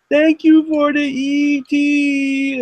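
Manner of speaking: put-on very high, slow little-kid slur; slurred toddler voice. Three-year-old thanking an uncle on the phone, slurred toddler voice. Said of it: "probably had slurred boy-, sa-, voice because I was, like, three".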